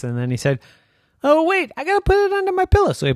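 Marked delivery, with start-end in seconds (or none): 1.2-2.9 sudden remembering. Acted. Daddy remembers in story.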